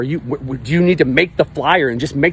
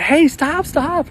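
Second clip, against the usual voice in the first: calling out; much slower than usual. Retold or imitated. Calling to the departing cart.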